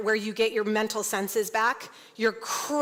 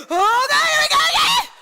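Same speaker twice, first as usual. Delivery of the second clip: much higher, louder crying shout; labor scream; 14 semitones higher, 7 dB louder than usual. Retold or imitated. Woman in labor wailing as a contraction comes, crying shout. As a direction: wail as the next push hits. Contraction hits while speaking.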